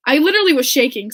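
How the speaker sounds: gushing with disbelief; excited outburst. Real, not acted. Fan telling viewers she shook, loud and fast. Reliving meeting hero, excited.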